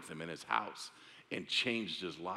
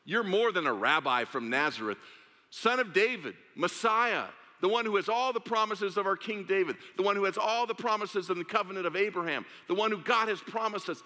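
high, loud pleading cry; shouting; 10 semitones higher, 4 dB louder than usual. Acted. Blind man shouting to Jesus over crowd, high loud cry. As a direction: cry out at the top of your lungs. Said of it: "he shouted at the top of his lungs".

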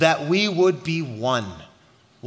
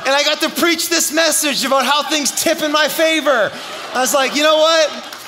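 high, loud, triumphant preacher; full-force preaching; 7 semitones higher, 5 dB louder than usual. Real, not acted. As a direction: punch it out over the crowd, much higher and louder. Preacher's climax of the story.

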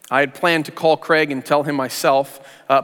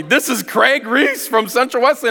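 higher, slow dawning surprise; sudden realization; 7 semitones higher, slower than usual. Retold or imitated. Realizing the caller is Craig, surprised and slow. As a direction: let the penny drop as you say the name.

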